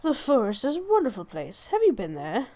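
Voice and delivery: put-on lively British accent with wide pitch swings. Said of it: "I gave you a British accent".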